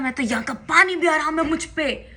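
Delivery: retold angry retort. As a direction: replay your furious line with a lift. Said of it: "मैं गई तो बहुत गुस्से में थी।".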